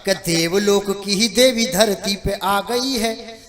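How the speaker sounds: measured, rhythmic stage poetry; verse recitation. Acted. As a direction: lay out the verse for the audience. Recites his comic verse. (लयदार कविता पाठ)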